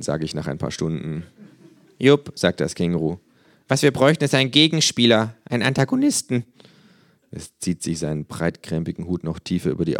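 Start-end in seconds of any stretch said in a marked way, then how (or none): 2.0-2.3 kangaroo voice. Acted. Känguru voiced by narrator. 3.7-6.4 higher, louder cocky kangaroo. Acted. Känguru voiced by narrator.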